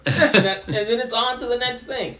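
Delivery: laughing amazement. Real, not acted. Telling the interviewer about Pete, laughing and amazed. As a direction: laugh through it, delighted by the story. Amused after the punchline.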